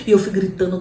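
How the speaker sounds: usual speaking voice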